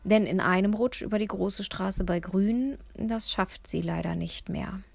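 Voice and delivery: ordinary delivery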